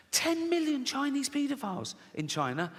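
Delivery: high-pitched incredulous repeat; mock astonishment. Shown as a demonstration. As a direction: marvel at it, pitched right up. Repeats statistic in mock amazement.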